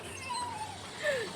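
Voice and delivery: sudden high giggle. Real, not acted. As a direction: burst into a high laugh. Laughs after chicken joke.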